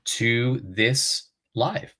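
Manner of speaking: bright, formal news anchor greeting; newscaster voice. Pretend. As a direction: open like a TV anchor going live. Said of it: "I sound like a newscaster right now".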